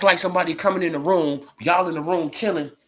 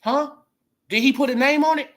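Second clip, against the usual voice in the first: normal talking voice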